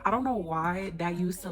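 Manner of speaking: ordinary talk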